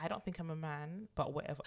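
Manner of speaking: soft, unbothered retelling; calm. Acted. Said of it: "she was calm".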